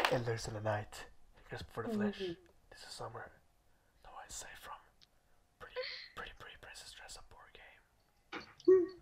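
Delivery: creepy whispered trailer read; horror trailer whisper. Pretend.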